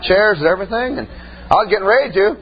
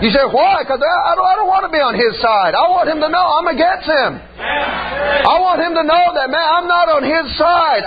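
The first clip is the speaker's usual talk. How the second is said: high, fervent preacher's cry; preaching at full force; 7 semitones higher than usual. Acted. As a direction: preach it out with fire.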